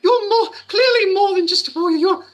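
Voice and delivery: high, loud adoring elf; high admiring elf woman. Acted. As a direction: gush with gratitude. Elf voice thanks warrior.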